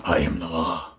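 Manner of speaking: low, restrained character voice; movie hero quote. Acted. Reviewer quoting Dredd to viewers, low put-on voice. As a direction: deliver the catchphrase low and held back.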